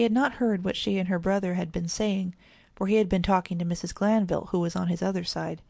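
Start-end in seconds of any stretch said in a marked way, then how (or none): none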